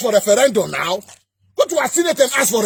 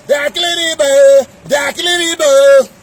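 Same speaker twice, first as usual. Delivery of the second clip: hammed-up mock cry of protest, louder; mocking complaint; 5 dB louder than usual. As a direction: parody their outcry in a put-on voice. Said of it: "then you say".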